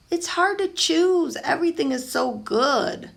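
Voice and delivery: put-on a kid who loves everything; torn boy. Pablo telling Mama he cannot choose, high boy voice.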